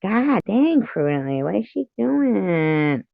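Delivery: high, slowed-down exasperation; drawn-out fed-up question; exasperated. Real, not acted. Streamer waiting on a late friend, exasperated and slow.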